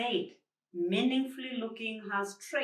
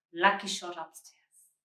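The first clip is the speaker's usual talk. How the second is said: plain reading voice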